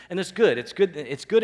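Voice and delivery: preacher pressing the point to his congregation, loud and high; emphatic good. Real, not acted. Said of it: "emphatic affirmation".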